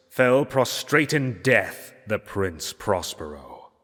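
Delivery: ordinary delivery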